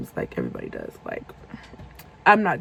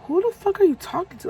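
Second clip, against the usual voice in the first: high-pitched indignant demand; mock furious retort; retold rowdy outburst; 6 semitones higher than usual. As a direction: snap back at him, fed up. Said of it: "that's when I started getting rowdy again".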